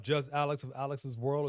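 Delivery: normal talking voice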